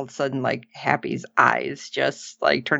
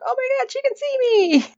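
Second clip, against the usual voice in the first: much higher, squealing with delight; super excited; much faster than usual. Retold or imitated. Happy realizing Amanda can see him, much higher and thrilled. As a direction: gush like you've just been noticed. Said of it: "he's just super excited".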